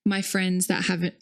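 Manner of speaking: normal talking voice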